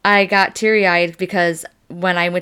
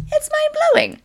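It's mind-blowing in a tiny, very high cartoon squeak; squeaky amazed repeat. Marvelling at the herbalist's blood test, a very high squeaky voice.

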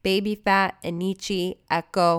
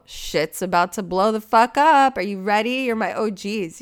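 hyped up; 3 semitones higher, 5 dB louder than usual. Real, not acted. Hyping up podcast listeners.

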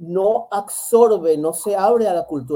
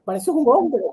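a hint of a shocked stern father, pitched higher; 9 semitones higher, 3 dB louder than usual. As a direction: challenge her as a bewildered strict father. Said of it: "el padre que dice".

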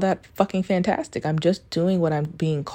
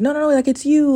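higher, louder insisting; insistent protest; 9 semitones higher, 5 dB louder than usual. Retold or imitated. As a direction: protest the praise emphatically. Acts out insisting to admirer.

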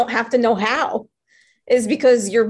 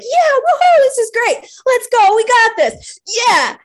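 high, loud whooping cheer; hyped-up celebration; excited cheer; 13 semitones higher, 6 dB louder than usual. Retold or imitated. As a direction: cheer like a thrilled inner voice. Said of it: "that one's like".

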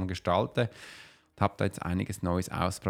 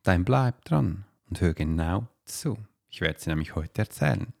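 right in your ear. Acted. Dramatic podcast teaser intro.

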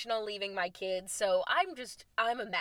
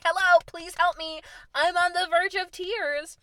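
higher and louder, hammed-up near-tears begging; mock desperate plea; 9 semitones higher, 8 dB louder than usual. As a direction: play the stranded girl on the verge of tears. Stranded girl pleading for help.

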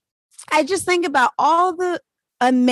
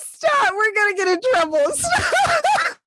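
giggling through the words; can barely speak for laughing; laughing hard; 9 semitones higher than usual. Real, not acted.

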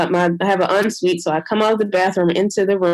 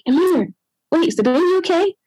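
anxious; 9 semitones higher, faster than usual. Retold or imitated. Anxious after birth.

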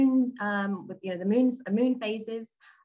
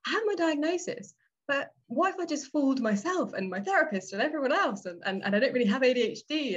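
lightly voiced worrier, pitched higher; acted self-doubt; 6 semitones higher than usual. Newly diagnosed person doubting themselves, mildly acted higher-pitched worry. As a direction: imitate someone second-guessing themselves in a raised pitch.

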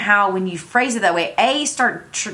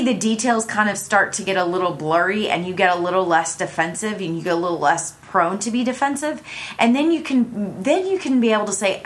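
ordinary talk, nothing marked

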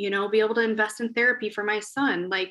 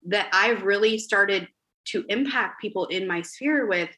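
ordinary talk

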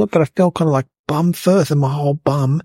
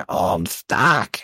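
much lower and very slow, a strained groan; groaning stuck; 9 semitones lower, 5 dB quieter, much slower than usual. Retold or imitated. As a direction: drag it out like you're wedged tight. Being pulled by the plunger.

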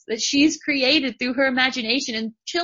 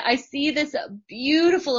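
usual speaking voice

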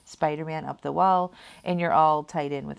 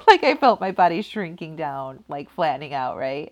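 laughing; 8 dB louder than usual. Real, not acted. Laughing while describing shrinking.

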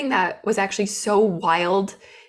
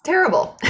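Terrible. thrown much higher and louder, then falling; emphatic repeat; 10 semitones higher, 4 dB louder than usual. Real, not acted. Host mocking herself as an employee, emphatic high Terrible. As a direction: punch Terrible. up high and let it drop.

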